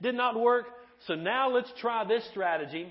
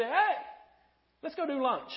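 high, sing-out friendly invite; breezy invitation; 6 semitones higher, 3 dB quieter than usual. Acted. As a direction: toss out a casual, higher-pitched invitation. Voices a casual lunch invitation.

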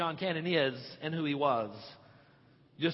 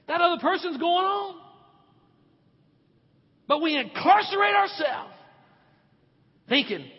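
climbing higher and louder, hammering each line; preaching at full force; 11 semitones higher, 8 dB louder than usual. Acted. Pastor to congregation, rising to a full-force preaching shout.

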